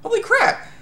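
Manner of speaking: very high squeal of shock. Retold or imitated. Stunned onlooker watching him dodge, high squeal. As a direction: yelp it out, amazed.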